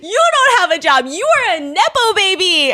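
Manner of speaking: high, drawn-out hammed-up shouting; mock furious yell. Host acts the wife telling him off, mock screaming. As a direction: yell it in exaggerated outrage.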